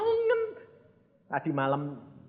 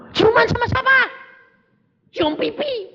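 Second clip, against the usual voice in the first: shocked question. Retold or imitated. Pastor shocked by the kissing news.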